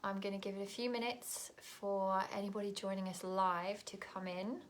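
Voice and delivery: ordinary talk, nothing marked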